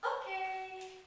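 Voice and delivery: high, bright "Okay"; chipper okay. Shown as a demonstration. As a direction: lift "Okay" up cheerfully. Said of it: "really chipper tone of voice".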